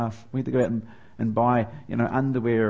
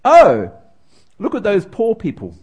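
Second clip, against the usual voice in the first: mock-snooty child, pitched up; a hint of a haughty eight-year-old; snooty little girl; 7 semitones higher, 10 dB louder than usual. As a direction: look down your nose at them. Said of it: "looks down her nose at these Burmese and says".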